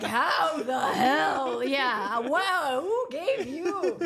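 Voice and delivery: loud, drawn-out mock outrage; laughing, hammed-up disbelief; indignant. Junior doctor to unreachable attending, indignant.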